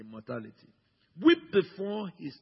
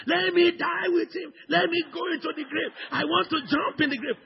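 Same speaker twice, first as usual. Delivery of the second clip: higher, pleading mourner's plea; mock wailing woman; 9 semitones higher, 4 dB louder than usual. Preacher imitates woman at graveside begging to die. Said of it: "she's saying that".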